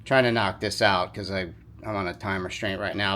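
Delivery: winded, talking between breaths; short of breath after the work. Real, not acted. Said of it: "sorry if I'm a little out of breath".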